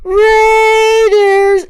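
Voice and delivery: put-on high, loud childish call; a little kid yelling the team name; little kid shout. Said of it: "you hear a little ass kid".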